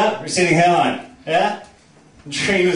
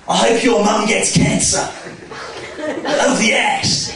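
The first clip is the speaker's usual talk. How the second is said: slow, raised-pitch imitation of an Aussie comic; 6 semitones higher, much slower than usual. Aussie comic answers a heckler, drawn-out mock Australian jibe. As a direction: stretch out an Australian-accented put-down. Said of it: "an Aussie comic that I once saw".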